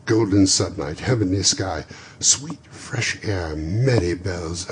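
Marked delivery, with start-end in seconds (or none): none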